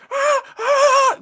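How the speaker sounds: high squealing mock Markiplier scream. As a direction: imitate a panicky gamer yelp. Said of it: "Markiplier, like, scream".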